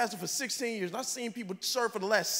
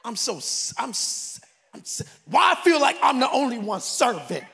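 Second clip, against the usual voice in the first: louder, fast griping; mock resentful complaint; 6 dB louder than usual. Pastor imitating a resentful server, griping. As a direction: vent like a bitter volunteer.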